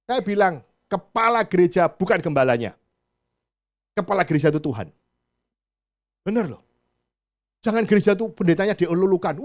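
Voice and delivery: loud, driving sermon voice; full-force preaching. Acted. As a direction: preach it out to the whole hall.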